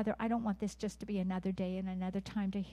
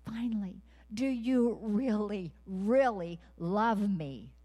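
pleading voice of God; 2 semitones higher, 6 dB louder, slower than usual. Acted. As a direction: press the question slowly and earnestly as the Lord. Voicing God asking again.

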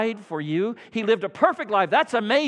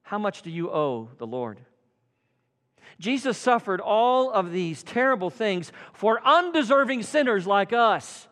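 ordinary talk, nothing marked

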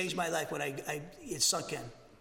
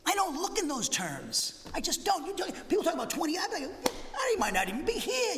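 much higher, insistent preaching pitch; emphatic urgency; 9 semitones higher than usual. Real, not acted. Pastor urging listeners not to count on years: emphatic high urgency. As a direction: drive home that tomorrow isn't promised.

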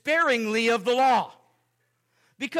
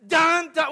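mock dramatic sting; 7 semitones higher, 6 dB louder than usual. Repeated sound-effect sting.

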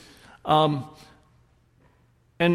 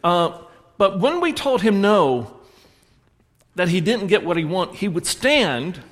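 ordinary delivery

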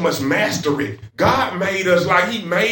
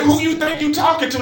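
higher, mock defiant challenge to God; indignant Cain; 8 semitones higher than usual. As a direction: play Cain squaring up to God, half-acted. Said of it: "That's how Cain was talking to God".

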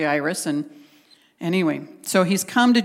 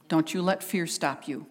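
ordinary talk, nothing marked

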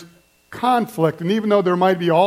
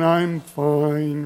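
mopey Eeyore; 5 semitones lower, 3 dB quieter than usual. Retold or imitated. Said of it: "That's Eeyore".

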